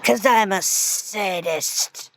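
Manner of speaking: slow, drawn-out admission; sadistic drawl. Acted. Tormentor explains himself to victim, slow sadistic drawl. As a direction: draw it out very slowly.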